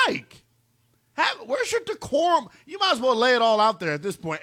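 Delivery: indignant, high-pitched scolding; exasperated rant. Acted. Host scolds the absent coach over cheating, exasperated. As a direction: lecture him in mounting disbelief.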